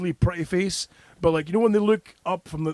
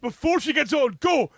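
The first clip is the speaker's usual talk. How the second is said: urgent high plea; 10 semitones higher than usual. Retold or imitated. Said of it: "I'm like".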